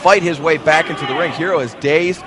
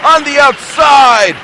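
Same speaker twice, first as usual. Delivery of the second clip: excited shout; 9 semitones higher, 7 dB louder than usual. Real, not acted. Live wrestling call, big moment.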